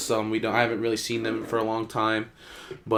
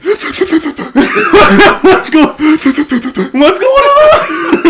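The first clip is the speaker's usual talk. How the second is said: put-on high, loud, stretched-out kid voice; bewildered little kid; 13 dB louder, much slower than usual. Little me running the lap, bewildered. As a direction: play your younger self struggling on the run. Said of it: "little me's like".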